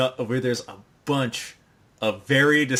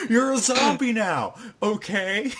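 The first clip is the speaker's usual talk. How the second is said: high, loud, laughing villain; over-the-top villain; mock villain shout; 9 semitones higher, 7 dB louder than usual. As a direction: bark it out in a hammed-up anime voice.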